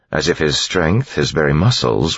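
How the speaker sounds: ordinary delivery, nothing marked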